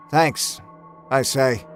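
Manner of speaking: ordinary delivery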